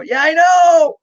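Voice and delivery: spring it on him, gleeful and loud; excited comeback. Retold or imitated.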